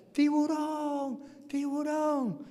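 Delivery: high, drawn-out cry of warning; imitated distant shout. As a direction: call out long and high, imitating faraway voices. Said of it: "cuando yo empiezo a escuchar".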